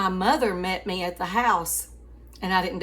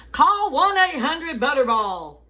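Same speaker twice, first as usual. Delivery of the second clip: radio ad voice; 8 semitones higher, 9 dB louder than usual. Retold or imitated. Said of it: "they were like".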